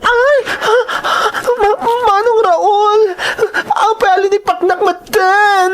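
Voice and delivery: high sobbing plea, crying out; crying out in pain. Acted. Sister beaten by brother, crying out and pleading. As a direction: beg through tears to stop.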